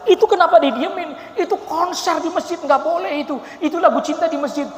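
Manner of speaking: high, loud half-laughing reproach; scolding Egyptian. Retold or imitated. Egyptian rebuking Indonesians about mosque songs, high amused scolding. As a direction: scold like a puzzled foreigner. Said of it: "ditegur atau dikasih tahu".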